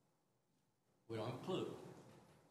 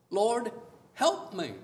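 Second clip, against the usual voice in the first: loud kneeling plea; pleading; 3 semitones higher, 8 dB louder, much slower than usual. Acted. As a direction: beg him for help out loud. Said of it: "knelt before him, saying".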